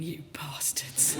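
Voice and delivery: a slow, bitter aside in a whisper; a hissed private curse; inner whisper. Retold or imitated. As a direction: whisper it under your breath. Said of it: "And I was thinking".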